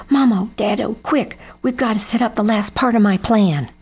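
rally them to hurry; urgent call. A narrator's reading. Jeb urgently summons his parents.

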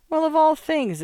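high-pitched exclamation of astonishment; exclaiming. A narrator's reading. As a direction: exclaim it high, as if taken aback. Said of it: "exclaimed Stell".

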